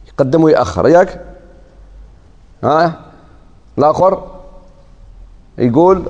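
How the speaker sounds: usual speaking voice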